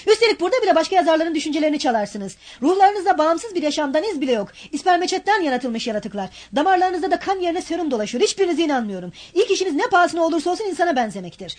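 high-pitched furious rant; angry tirade. Acted. Razumihin ranting at Raskolnikov, high angry tirade. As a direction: rail against them all.

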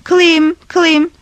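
loud, high call into the dark forest. A narrator's reading. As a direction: shout the name into the woods. Calling the driver in the forest. (ormanda yüksek sesle seslenme)

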